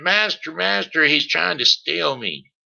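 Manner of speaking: high, loud alarmed scream; screaming harp. Acted. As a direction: cry out to the master in alarm. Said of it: "harp started to screaming".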